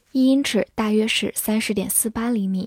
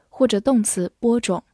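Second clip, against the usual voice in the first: normal talking voice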